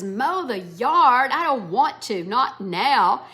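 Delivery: loud, slow, irritated protest; a teenage son protests mowing the yard, loud and irritated. Acted. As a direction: push back on the chore, annoyed and loud. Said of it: "Blake is irritated".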